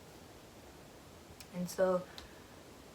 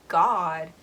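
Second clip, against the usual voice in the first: gruff big man; 2 semitones lower, 10 dB louder than usual. Retold or imitated. Big man Jeopard's gruff entrance.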